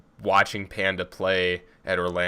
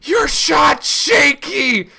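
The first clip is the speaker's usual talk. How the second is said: high, slow, drawn-out taunting scream; crowd scream; 12 dB louder, much slower than usual. Retold or imitated. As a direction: heckle like a rowdy crowd. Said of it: "we would just scream at Silly".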